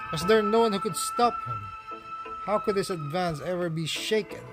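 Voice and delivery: ordinary delivery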